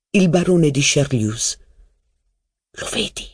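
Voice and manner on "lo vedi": hushed whisper. A narrator's reading. Robert whispering to narrator about his uncle. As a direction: whisper it so no one hears. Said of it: "mi sussurrò Robert".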